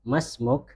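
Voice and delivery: "Mas muk" closed on the k, no vowel after; percakapan, akhiran disukun. Shown as a demonstration. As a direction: say conversational "Mas muk", stopping at k. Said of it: "itu belakangnya disukun". ("Mas muk", huruf akhirnya dimatikan)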